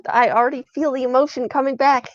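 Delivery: plain reading voice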